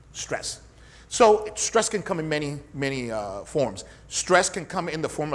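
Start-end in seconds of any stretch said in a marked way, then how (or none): 0.1-0.6 whispered. Shown as a demonstration. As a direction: Stress breathed out as a whisper. Dramatic single-word emphasis.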